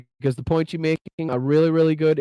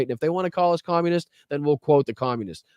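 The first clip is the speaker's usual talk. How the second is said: ordinary delivery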